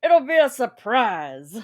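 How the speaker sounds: slow, loud villain tease; mad scientist. Pretend. Playing along with the evil laugh.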